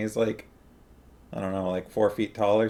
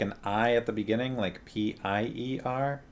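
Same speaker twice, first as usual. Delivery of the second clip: ordinary delivery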